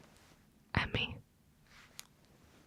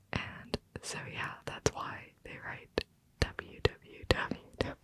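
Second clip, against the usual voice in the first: ordinary talk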